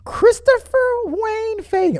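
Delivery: high, slow, stretched full-name call; mom's full-name call. Retold or imitated. Mom calling her son in when the streetlights came on, stretched scolding. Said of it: "as my mom would say".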